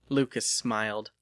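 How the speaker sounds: ordinary delivery, nothing marked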